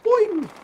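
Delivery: high, loud, springy boing; "boing" jump noise. Real, not acted. Gamer adding a sound effect to play, high boing. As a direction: squeal a springy cartoon boing.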